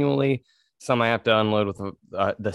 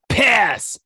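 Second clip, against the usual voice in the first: loud blurted curse; exclaiming; 11 semitones higher, 5 dB louder than usual. Retold or imitated. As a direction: say it right at him, loudly. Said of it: "I was all".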